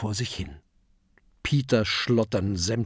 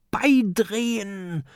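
high, drawn-out command; high character shout; calling out; 10 semitones higher, slower than usual. A narrator's reading. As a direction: call the order out long and high. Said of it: "ruft Oskar".

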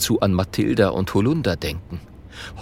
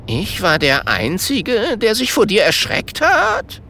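higher, rising disbelief; astonished; 9 semitones higher than usual. A narrator's reading. Goat looking up at the ghost, astonished question. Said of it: "jetzt erstaunt zu dem Gespenst hinaufblickt und fragt".

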